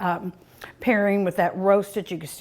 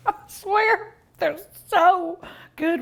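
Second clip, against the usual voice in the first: high, giggly oath; helpless high-pitched giggle; laughing; 8 semitones higher, slower than usual. Real, not acted. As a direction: squeal it through a laugh. Giggling while gushing over food.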